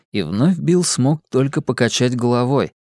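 ordinary delivery

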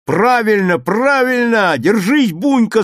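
high, loud cheering on; class shouting. A narrator's reading. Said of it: "закричал класс". (класс кричит, подбадривая)

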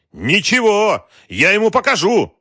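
loud, high-pitched shout of rage; furious threat. A narrator's reading. Wolf reaching for the phone, raging.

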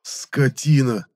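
недоброжелательно. A narrator's reading. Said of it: "недоброжелательно глядя на Моню".

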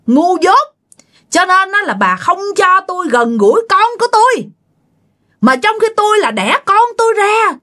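high, wailing reproach from a dead mother; ghost's angry tirade. Acted. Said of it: "bà nghe bà Hảo chửi vậy nè".

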